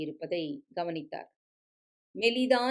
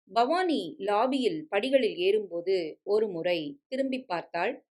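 ordinary delivery

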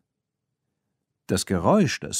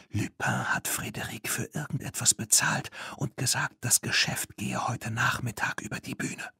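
softer unvoiced whisper; 3 semitones higher, 7 dB quieter than usual. Tina telling Claire what they overheard, whispering. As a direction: report it under your breath. Whispered report while hiding.

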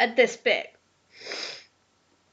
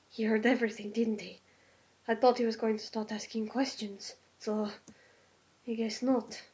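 softer, slightly lowered character voice; lightly voiced uneasy character; 2 semitones lower, 7 dB quieter than usual. Character realising he was overheard, lightly voiced quiet aside. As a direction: voice a character quietly thinking to himself.

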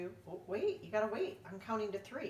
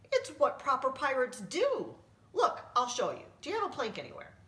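eager explaining; 7 semitones higher, 6 dB louder than usual. Acted. Narrator explaining excitedly.

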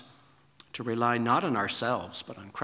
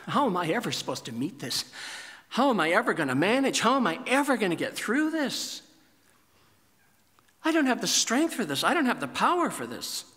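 mock panic, building; 7 semitones higher, faster than usual. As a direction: act out rising panic. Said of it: "begin to think to yourself".

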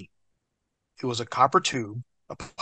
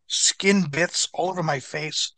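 ordinary delivery

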